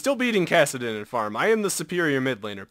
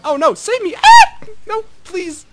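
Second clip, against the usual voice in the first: high, loud hammed-up cries for help; a shrieking fake plea; mock panic; 14 dB louder than usual. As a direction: squeal for rescue, half joking. Panicking in a teamfight.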